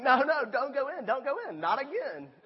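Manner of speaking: loud, high-pitched urgent warning; mock alarm. Viewer warning characters off the dark room, mock-alarmed pleading. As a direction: plead with the screen, playfully panicked. Acts a viewer of scary movie.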